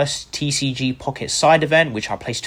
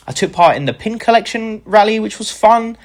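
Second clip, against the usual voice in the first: ordinary talk, nothing marked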